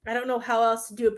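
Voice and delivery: ordinary delivery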